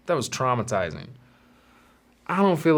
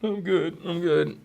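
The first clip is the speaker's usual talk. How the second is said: brave through tears; 7 semitones higher, slower than usual. Retold or imitated. Crying kid pretending fine.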